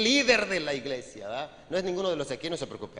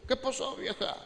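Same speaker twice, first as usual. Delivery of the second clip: put-on higher, soft drunken greeting; drunk husband's voice; 6 semitones higher, 6 dB quieter than usual. Shown as a demonstration. Drunk husband greets his wife at night, higher and softer. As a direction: lift the pitch and soften, a tipsy husband. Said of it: "No, no habla así".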